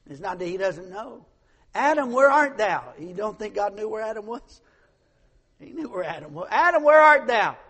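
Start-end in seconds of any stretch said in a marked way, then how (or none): 1.8-2.9 loud, high call across the garden; God calling out. Retold or imitated. God calling Adam, loud and high. 6.5-7.6 louder, higher ringing call; God calling out. Retold or imitated. God calling Adam again, very loud and high.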